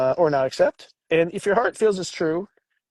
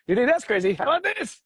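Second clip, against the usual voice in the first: rising to a much higher teasing challenge; Uranus voice; 8 semitones higher than usual. Acted. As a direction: playfully taunt like a cosmic trickster. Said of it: "Uranus ... goes for seven or eight years going".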